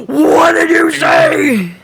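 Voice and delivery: slow, stretched, distorted voice; disguised deep voice. Retold or imitated. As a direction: drag it out like a garbled entity. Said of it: "where Ion was just like".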